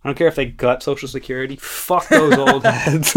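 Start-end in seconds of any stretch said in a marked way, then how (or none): 1.6-3.1 drawn-out. Pretend. Joking callous remark.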